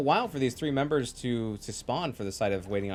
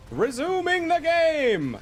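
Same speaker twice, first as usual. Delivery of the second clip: announcer shout; 6 dB louder, slower than usual. Real, not acted. Announcing the game restarts.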